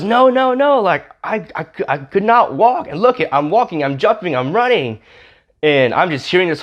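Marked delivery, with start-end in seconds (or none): 0.0-5.0 high, stammering mock disbelief; acted-out frantic amazement; retold screaming excitement. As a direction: imitate someone overwhelmed, voice leaping way up. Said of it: "just hearing the scream over the mic".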